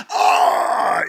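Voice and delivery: loud, high acted howl; sudden imitated scream of agony; cry of pain. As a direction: yell as if a jolt hurts. Acting out pain at every pothole.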